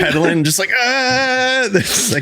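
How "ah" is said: high squeaky panicked yelp; mock yelp. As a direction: cry out like a fleeing kid. Paperboy cry while fleeing.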